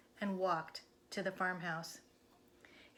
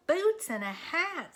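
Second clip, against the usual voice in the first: high, louder, slow disbelief; baffled Minnie; 9 semitones higher, 6 dB louder, slower than usual. Acted. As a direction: repeat it in disbelief. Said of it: "said Minnie".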